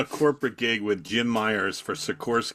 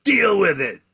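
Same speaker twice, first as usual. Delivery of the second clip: louder, higher snap; defiant retort; 9 semitones higher, 7 dB louder than usual. Retold or imitated. Little guy on stage refusing the comic, defiant and loud. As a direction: throw the refusal back hard. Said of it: "And he goes".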